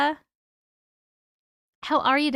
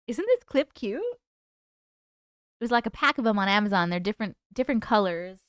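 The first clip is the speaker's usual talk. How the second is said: ordinary delivery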